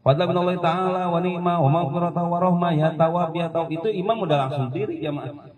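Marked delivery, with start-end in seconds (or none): 0.1-3.7 a light, quick bilal's chant, higher; imitating the bilal. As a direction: rattle off the bilal's call. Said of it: "Bilal ngomong". (menirukan seruan bilal dengan cepat)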